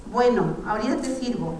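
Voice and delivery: ordinary delivery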